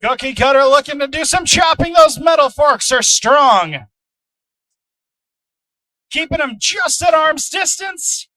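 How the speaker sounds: excited, loud fight commentary; live play-by-play. Acted. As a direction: call the action as it happens.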